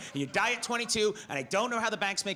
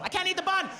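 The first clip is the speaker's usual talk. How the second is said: higher, plaintive complaint; whiny fighter; 8 semitones higher than usual. Retold or imitated. UFC fighter refusing the bun mid-bout. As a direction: whine it like a picky fighter.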